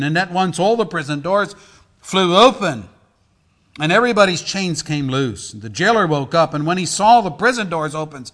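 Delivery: loud, driving Bible narration; dramatic scripture reading. Acted. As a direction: preach the story with punched peaks. Reads scripture story with force.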